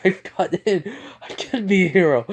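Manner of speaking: high-pitched, excited announcement; overjoyed. Acted. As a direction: burst out with the good news. Boy celebrates getting into the school.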